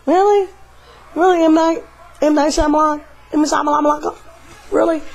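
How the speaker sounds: high incredulous "really?"; disbelief. Real, not acted. Incredulous at director.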